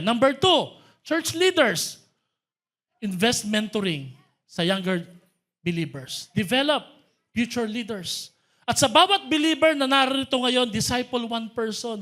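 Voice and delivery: rally the church leaders from the pulpit; fervent preaching. Acted. Preaches loudly to the congregation.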